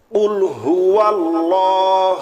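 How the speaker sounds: slow, drawn-out chanted verse; slow recitation. Shown as a demonstration. Teacher models the verse slowly for students, chanted. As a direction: intone it slowly like tilawat. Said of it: "ঠিক আছে?".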